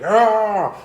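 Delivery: sudden high, loud crazed shout; crazy yell. Shown as a demonstration. Drawing teacher shows Forkman's madness with a crazed yell. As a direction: let out a deranged yell. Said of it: "he's gotta be a little crazy, like".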